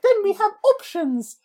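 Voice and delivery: very high, piping orphan voice; squeaky London waif from Dickens; Dickensian orphan. Acted. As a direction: play a little Dickensian urchin. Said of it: "sound like a Dickensian orphan".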